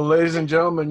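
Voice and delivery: ordinary talk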